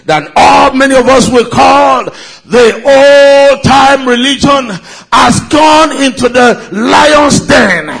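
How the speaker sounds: rising fervent sermon voice; preaching. Acted. Preaching at full force.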